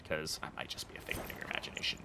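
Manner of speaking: eerie mock whisper; spooky whisper. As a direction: whisper it like a phantom teasing chat. Teasing chat about being imaginary.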